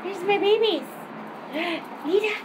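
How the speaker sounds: doting voice. Real, not acted. Greeting her dogs.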